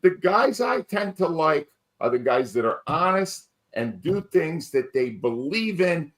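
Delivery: ordinary talk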